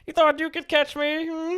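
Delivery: high villain taunt; cartoon villain. Hacked-TV villain.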